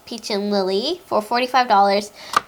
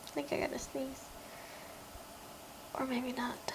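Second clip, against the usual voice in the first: hushed; 3 semitones higher, 21 dB quieter than usual. Real, not acted. About to sneeze, hushed.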